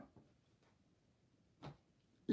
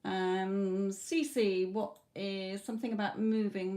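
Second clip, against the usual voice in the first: ordinary talk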